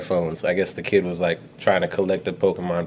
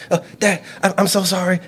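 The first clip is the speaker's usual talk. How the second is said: pleading daughter; 11 semitones higher than usual. Retold or imitated. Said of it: "she started".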